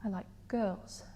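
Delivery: very soft, small admission; hushed confession. Acted. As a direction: drop right down, as if confessing it to yourself. Monologue confession, much softer take.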